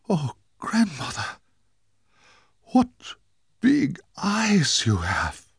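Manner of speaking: put-on high, slow, astonished girl voice; gasping little girl. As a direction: gasp it out in wide-eyed shock. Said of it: "she gasped".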